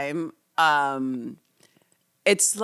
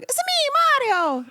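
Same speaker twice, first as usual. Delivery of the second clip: high, bouncy "It's a me, Mario" in a mock Italian accent; quoting Mario in a cartoon Italian accent. A friend quoting Mario in a cartoon Italian accent. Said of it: "they go like".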